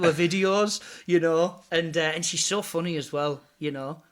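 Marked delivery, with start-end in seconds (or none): none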